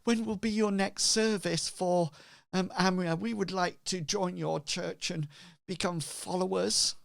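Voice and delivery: high-pitched earnest villager; eager would-be follower. Acted. Villager asking the cleric to join the church, high eager voice. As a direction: ask it high and keen. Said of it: "Bartleby says".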